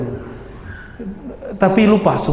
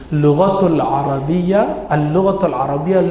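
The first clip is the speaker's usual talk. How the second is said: ordinary talk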